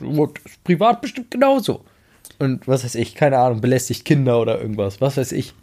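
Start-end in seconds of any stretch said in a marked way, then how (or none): 0.0-1.8 mock outrage. Mocking what people would say.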